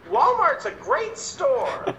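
mom's high, drawn-out heckle; mock heckling yell. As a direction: call it out high and slow, imitating an interrupting mom. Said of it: "she's just yelling out".

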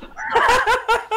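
high squealing laughter; breaking up completely; hysterical laugh. Real, not acted. Laughing after retold line.

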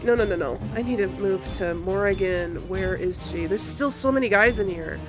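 usual speaking voice

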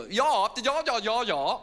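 very high, loud yah-yah babble; mock posh-English gibberish as foreigners hear it; mimicked English woman. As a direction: parody an Englishwoman with shrieky nonsense. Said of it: "this English woman saying".

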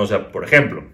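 ordinary talk, nothing marked

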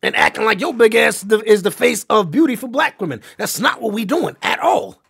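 loud, heated objection; emphatic rebuttal. Real, not acted. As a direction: push back hard, punching the words out loud.

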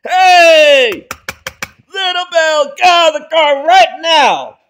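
loud, high furious yell; furious shout. Acted. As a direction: scream the order at him. Dad yells at son.

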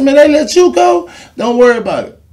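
Jerry Jones on the phone. Retold or imitated. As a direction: tell him not to worry, loud and high.

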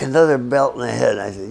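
usual speaking voice

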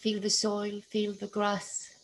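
usual speaking voice

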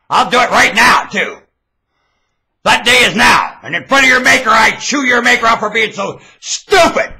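high, very loud rant; furious high yelling; angry shouted tirade. Real, not acted. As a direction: chew someone out at full voice. Shouts at the Maker.

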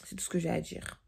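whispered sign-off. Real, not acted. Whispering goodbye to viewers at night. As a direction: drop to a whisper to close.

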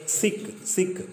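"Sikh" said softly, almost unvoiced; teacher quietly models the word for pupils to copy; soft modelled word. Shown as a demonstration. Hushed model reading. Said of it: "teacher models word softly".

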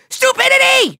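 high, exclaimed insult; scornful outburst. Acted. Villain insulting annoying Skylanders, scornful outburst. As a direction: spit it out at a high pitch.

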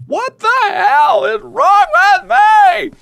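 high, slow wail at yourself; exasperated outburst. Real, not acted. Player berating themself after a mistake, exasperated wail. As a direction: draw it out in exasperation.

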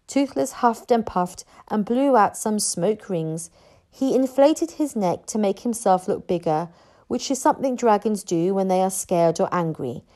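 ordinary delivery

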